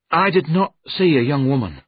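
alarmed denial. Acted. Jerome's alarmed denial in story.

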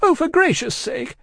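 high, loud distraught cry; wildly crying. A narrator's reading. Miss Pross hurrying Cruncher along, wildly distraught. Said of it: "still wildly crying".

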